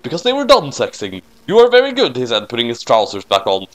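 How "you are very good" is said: much higher, a touch louder; lightly voiced admiring line; king of Shinigami praises Noami, high and mock-sweet; high compliment.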